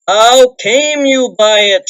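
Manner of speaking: slow, loud, rising rage; dwarf king shouting in gathering fury; angry shout. A narrator's reading. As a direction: bellow it at the thief. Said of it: "shouted Thorin in gathering rage".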